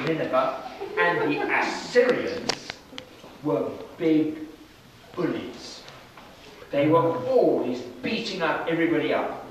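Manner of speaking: plain reading voice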